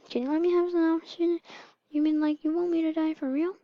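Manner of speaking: high-pitched little voice; acted small, high plea; pleading baby. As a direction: ask anxiously in a high baby voice. Baby questions the mother.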